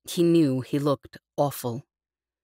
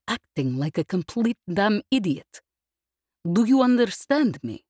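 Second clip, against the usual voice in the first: stern scolding; 5 semitones higher than usual. Acted. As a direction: scold him like a fed-up commander, rising to the last question. Yesbel scolds hungover Liv.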